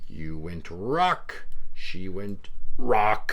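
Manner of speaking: very slow, drawn-out result; slow dramatic reveal. Acted. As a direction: reveal each pick slowly.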